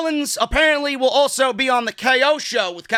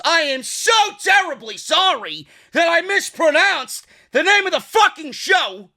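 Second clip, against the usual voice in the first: high, loud, exaggerated mock apology; hammed-up sorry, pitched up and forceful; sarcastic apology; 7 semitones higher, 5 dB louder than usual. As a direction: apologise with exasperated, over-the-top sarcasm. Mock apology for misnaming the show.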